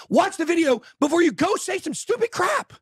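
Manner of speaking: ranting host yells at Twitter critics; scolding shout. Retold or imitated. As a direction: yell it at the Twitter trolls. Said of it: "you need to say".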